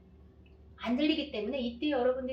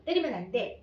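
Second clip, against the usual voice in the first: put-on sharp loud "멈춰!" to halt a child; mom's firm shout to stop hitting; firm stop command; 4 semitones higher, 6 dB louder than usual. Shown as a demonstration. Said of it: "이렇게 해야 될 거예요".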